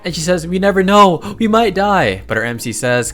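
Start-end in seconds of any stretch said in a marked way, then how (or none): 0.5-2.2 put-on high, loud frightened girl's voice; scared. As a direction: raise it into an anxious, pleading pitch. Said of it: "this girl is actually so scared".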